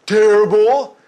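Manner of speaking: loud, high-pitched mock complaint; grumbling checkout clerk. Checkout clerk answering a friendly greeting, loud high grumble. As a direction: blurt out the misery, high and loud.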